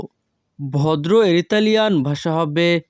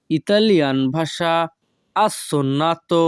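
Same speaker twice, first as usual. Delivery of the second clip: ordinary delivery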